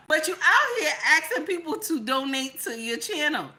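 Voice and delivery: usual speaking voice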